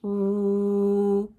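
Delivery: "ooh" held long on one steady note; long, level "ooh"; stretched-out sound. Shown as a demonstration. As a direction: sustain "ooh" evenly as a phonics sound. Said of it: "This sound says".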